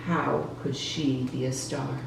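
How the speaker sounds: plain reading voice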